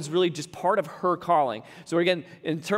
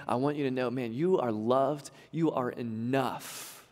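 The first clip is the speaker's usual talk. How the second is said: soft, slow and lower, speaking straight to each mom; reassuring; 6 semitones lower, 6 dB quieter than usual. Real, not acted. Pastor assuring weary moms they are loved, soft and slow. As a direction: gently tell them they are loved and enough.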